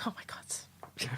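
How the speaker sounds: breathy unvoiced whisper. Whispering her reaction to friend. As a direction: whisper it in mock awe.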